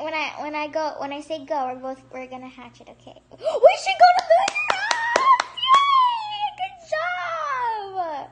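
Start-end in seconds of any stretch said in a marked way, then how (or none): 3.3-8.3 high, loud cheering squeal; high, loud, overjoyed squeal; excited shriek. Real, not acted. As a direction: cheer her on with a delighted shriek. Friend hatches lunar ox.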